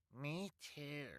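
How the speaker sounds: high, sneering henchman quip. Acted. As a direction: chime in like a smug crony. Bald man voiced.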